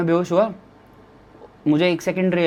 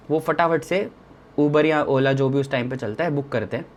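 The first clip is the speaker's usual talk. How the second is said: normal talking voice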